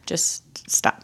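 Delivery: whispered, half-joking warning. Real, not acted. Whispering to underage listeners to just stop. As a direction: breathe it as a hushed aside.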